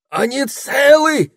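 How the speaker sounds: very high, loud drawn-out squeal; frantic high-pitched squealing. A narrator's reading. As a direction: shriek the question in a high, slow squeal. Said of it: "взвизгнула Табита".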